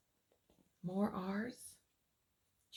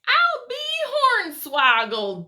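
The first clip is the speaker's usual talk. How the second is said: astonished exclaim; 11 semitones higher, 11 dB louder than usual. Acted. Character exclaims in surprise.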